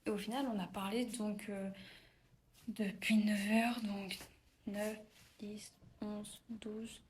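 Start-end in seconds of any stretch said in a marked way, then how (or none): 4.7-7.0 counting softer and softer down to a whisper; fading count. Real, not acted. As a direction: let the count fade into a whisper. (compter en s'éteignant jusqu'au chuchotement)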